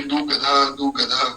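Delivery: दू गधा split in two, "doo gadha"; misheard pronunciation. Retold or imitated. Simple disciple repeating guru's misheard word, दू गधा as "doo gadha". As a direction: drag out दू गधा as two separate words, slowly. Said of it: "आप बार बार जो है कथा में कह रहे थे".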